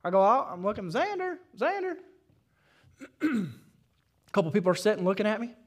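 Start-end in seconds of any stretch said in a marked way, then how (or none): 0.9-2.0 high, far-carrying call for a lost son. Retold or imitated. Father searching the play place, calling his son. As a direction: call his name across the restaurant.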